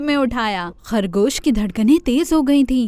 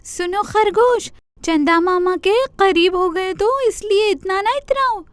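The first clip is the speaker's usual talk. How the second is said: scolding deer; 8 semitones higher than usual. Acted. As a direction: lecture the spoiled rabbit. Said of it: "ہرن بھی تب گیا".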